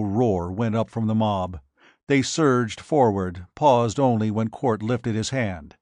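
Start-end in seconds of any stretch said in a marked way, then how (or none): none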